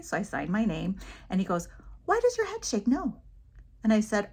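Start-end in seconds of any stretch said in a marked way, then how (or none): 2.0-3.2 put-on high-pitched curious child's question; little boy. Little boy asks teacher about her head, high and curious. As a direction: lightly imitate a puzzled little boy. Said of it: "one little boy comes up to me".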